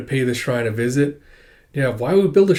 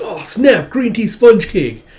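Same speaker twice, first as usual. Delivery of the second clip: loud, higher gush, slowing on the cake; thrilled over a snack; delighted squeal; excited delight; 8 semitones higher, 6 dB louder, slower than usual. Acted. Alma finds a snack box.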